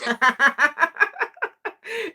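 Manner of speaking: loud high laugh; high burst of laughter. Real, not acted. Laughing at the clip.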